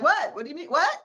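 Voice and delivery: high-pitched, incredulous mock surprise; taken aback. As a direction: act out a startled, puzzled comeback. Retelling own startled reply to daughter.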